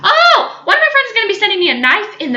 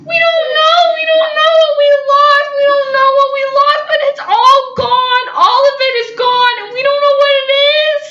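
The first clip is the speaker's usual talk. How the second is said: high, loud half-sung wail; hammed-up distressed sing-song wail; mock wailing panic; 7 semitones higher, 5 dB louder than usual. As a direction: wail like it's a tragedy. Panicked roommates wailing loss.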